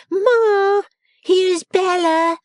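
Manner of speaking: high, loud, drawn-out call; bawling. A narrator's reading. Miss Lavvy announcing her sister to Ma, bawling. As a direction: bawl it through the house, high and slow. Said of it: "and then bawled".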